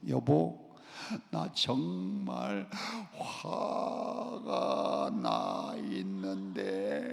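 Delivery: very slow, soft, half-laughing complaint; slow anger. Pretend. Pastor models telling wife of anger, slow and soft. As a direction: ease the anger out gently. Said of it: "화날 때 천천히 화내세요".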